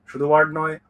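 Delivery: usual speaking voice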